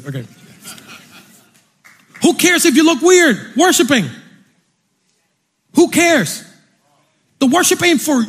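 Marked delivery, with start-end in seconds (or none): 2.2-8.2 high-pitched, emphatic preacher's proclamation; fervent preaching. Acted. Preacher refusing praise for the message, fervent. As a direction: drive each phrase home with fervor.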